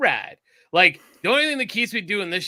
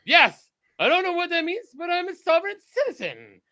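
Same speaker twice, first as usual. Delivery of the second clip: high-pitched clueless mock insistence; Casey; 13 semitones higher, 4 dB louder than usual. Mocking Casey who won't back down, high-pitched insistence. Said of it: "He'd be like".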